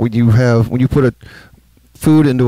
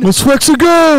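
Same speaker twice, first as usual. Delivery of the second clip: high, called-out mock cheer; retold rallying call; 14 semitones higher, 4 dB louder than usual. Rallying the group to leave the repair, excited call. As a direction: call it out high to get moving.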